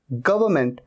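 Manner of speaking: गवर्नमेंट as "GUV-uh-ment"; correct government. Shown as a demonstration. Teacher modelling "guv-uh-ment". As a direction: drop r and n in गवर्नमेंट. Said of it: "गवर्नमेंट नहीं".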